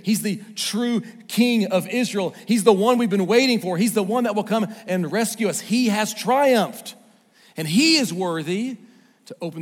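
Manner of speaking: rally the congregation to the king; preaching at full force. Acted. Preacher proclaims the triumphant king, full force.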